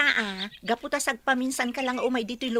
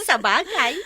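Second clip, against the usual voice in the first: laughing; 5 semitones higher, 5 dB louder than usual. Acted. Laughing at friend's joke.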